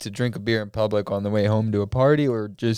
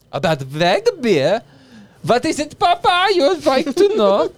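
high, loud hammed-up German accent; mock a German dad, loud; silly German voice; 7 dB louder than usual. Silly accent voice questioning Juice.

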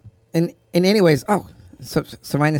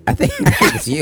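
laughing. Real, not acted. Laughing while accusing brother.

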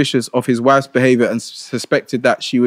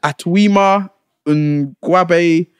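sounding out a long place name; slow careful reading; 4 semitones higher, much slower than usual. Real, not acted. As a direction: slowly sound out Atwima Ngwabe. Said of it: "the way they name places in".

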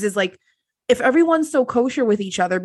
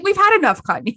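high, lightly exasperated mock line; mock exasperated quote; 9 semitones higher than usual. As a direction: toss it off, mildly fed up. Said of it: "I was like".

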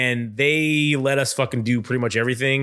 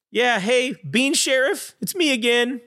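much higher, whiny complaining voice; mock phone call; 10 semitones higher than usual. Clerk phoning the bean sheriff, in a high whiny voice. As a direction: play a hapless shopkeeper calling it in.

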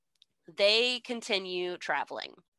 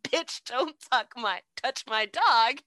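sassy scolding; 4 semitones higher than usual. Retold or imitated. Dorothy scolds lion again.

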